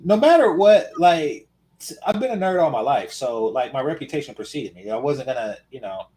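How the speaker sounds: ordinary delivery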